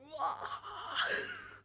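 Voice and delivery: high relieved cry. Retold or imitated. The baby coming out.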